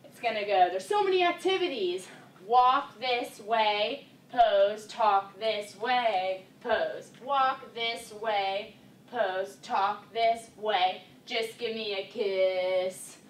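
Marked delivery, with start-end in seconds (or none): 0.7-2.1 high-pitched, mock-thrilled line; Step Brothers quote. Dance teacher marking off the quote for dancers, high-pitched. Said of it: "kind of marking off the words". 2.5-13.2 very slow, stretched-out dance cue words; marking the words. Shown as a demonstration. Dance teacher marking off the words to the steps, slow and drawn out. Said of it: "kind of marking off the words".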